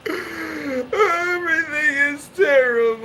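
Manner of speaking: hammed-up grandma bawling it out; mock sobbing shout. Grandmother despairing over the baptism, mock sobbing shout. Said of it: "she's crying and crying".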